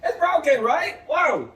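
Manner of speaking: high, big-eyed teacher reaction; exaggerated shock. Shown as a demonstration. Teacher shows pupils the broken sandcastle, shocked.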